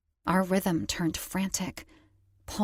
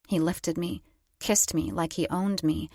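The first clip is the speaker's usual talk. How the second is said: ordinary delivery, nothing marked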